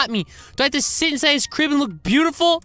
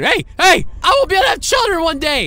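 panicked shout; 3 semitones higher, 4 dB louder than usual. Acted. Kid protests x-ray.

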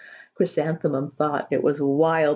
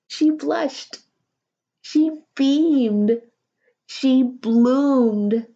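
higher, growing louder with each phrase; building joy; 6 semitones higher, 4 dB louder, much slower than usual. A narrator's reading. Narrator rejoicing as Chrysanthemum blooms, building excited. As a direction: let her joy bloom line by line.

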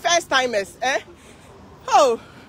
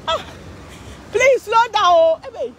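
calling out; 8 semitones higher, slower than usual. Real, not acted. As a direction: shout out a pleading request. Pleading with band to slow.

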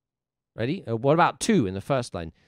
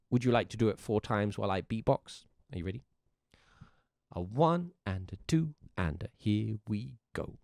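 ordinary delivery

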